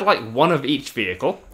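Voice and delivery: usual speaking voice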